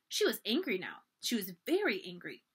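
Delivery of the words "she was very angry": ordinary delivery, nothing marked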